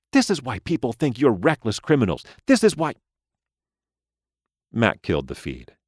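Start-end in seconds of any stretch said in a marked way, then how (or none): 0.1-2.9 high, rising scolding doll voice. A narrator's reading.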